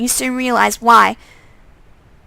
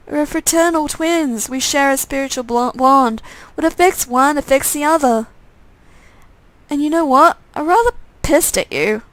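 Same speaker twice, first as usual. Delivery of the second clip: irritated undead twin; 3 semitones higher than usual. Acted. Undead twin telling his brother he's pissed off, petulant. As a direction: explain the bond, then sulk.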